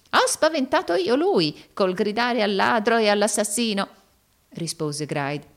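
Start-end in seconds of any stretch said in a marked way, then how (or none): 0.1-3.9 high, loud character voice; boastful schemer. A narrator's reading. Arturo boasting to Rodolfo of scaring the intruder, high excited voice. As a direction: protest proudly, pitched up and forceful.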